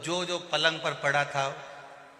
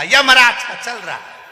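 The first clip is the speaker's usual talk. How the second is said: higher, louder angry snap with a laugh; angry retort; 8 semitones higher, 12 dB louder than usual. Retold or imitated. Annoyed patient answers the deaf visitor, angry retort. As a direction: snap back in anger. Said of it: "अब इसको आ गई गुस्सा".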